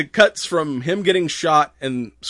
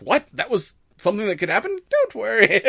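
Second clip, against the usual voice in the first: alarmed; 9 semitones higher than usual. Retold or imitated. Characters' alarmed reply.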